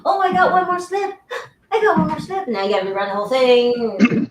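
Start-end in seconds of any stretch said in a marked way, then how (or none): 0.0-2.5 high, whining mock client voice; whiny client. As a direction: mimic an annoying client begging with one more slip. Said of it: "people coming in".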